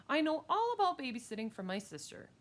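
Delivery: high-pitched know-it-all; boastful girl. Acted.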